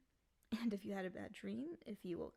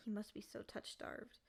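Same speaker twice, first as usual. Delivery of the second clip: usual speaking voice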